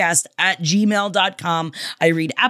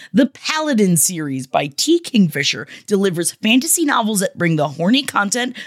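ordinary talk, nothing marked

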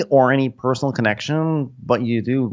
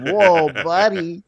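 mock startled yelp; 7 semitones higher, slower than usual. Echoing the quote.

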